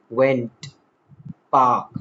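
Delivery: "went" and "park" each alone, falling; emphasized words. Shown as a demonstration. Teacher picking out stressed words, emphatic. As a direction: set "went" and "park" apart with full weight. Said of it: "while emphasizing".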